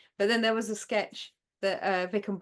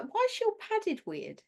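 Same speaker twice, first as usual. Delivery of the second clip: baffled; 12 semitones higher than usual. Retold or imitated. Baffled reaction retold.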